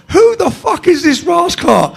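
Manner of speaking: slow, high, loud imitated yell; retold screaming. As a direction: holler it like an inmate across the wing. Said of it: "They're all screaming".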